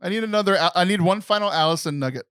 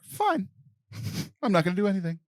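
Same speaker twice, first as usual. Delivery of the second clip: huffy; 5 semitones higher, faster than usual. Retold or imitated. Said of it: "Martha being like".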